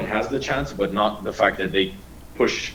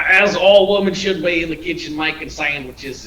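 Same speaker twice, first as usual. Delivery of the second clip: mock sexist joke; 8 semitones higher, 4 dB louder than usual.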